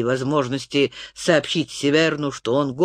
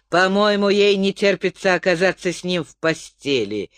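ordinary delivery

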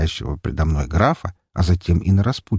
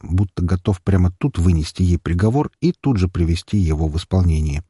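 usual speaking voice

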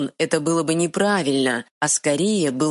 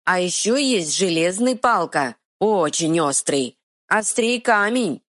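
higher broken-speech troll; 5 semitones higher than usual. Acted. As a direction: dim troll explaining earnestly. Troll explains the knights.

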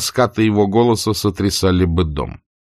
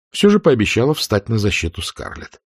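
ordinary delivery, nothing marked

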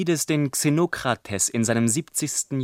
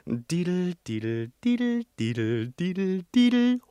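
soft fiddle tune; 5 dB quieter than usual. Acted. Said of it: "dass es ihm sanft tut".

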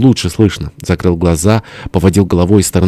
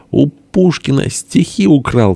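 high, drawn-out accusation; worked-up cry; 8 semitones higher, slower than usual. A narrator's reading. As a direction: cry it out in rising indignation. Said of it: "крикнул тоже и Бенедикт, распаляя сердце". (распалённый возмущённый выкрик)

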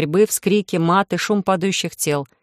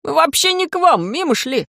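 scared high voice; 9 semitones higher than usual. Acted. Scared thug makes excuses.

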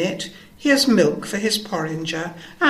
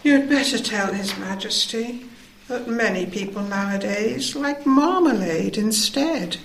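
high, drowsy cow voice, drifting off; sleepy; 11 semitones higher than usual. Acted. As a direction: sound half asleep, like a dozy cow. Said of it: "The Alderney said sleepily".

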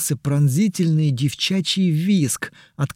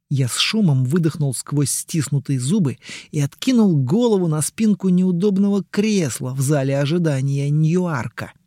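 ordinary delivery

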